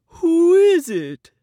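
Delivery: loud, high, slow bear call; storybook bear calling loudly; asking bear. A narrator's reading. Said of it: "asks Bear".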